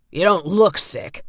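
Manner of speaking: mocking orderly; sneering. A narrator's reading. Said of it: "the second man said".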